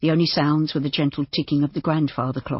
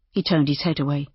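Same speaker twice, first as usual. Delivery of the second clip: ordinary delivery, nothing marked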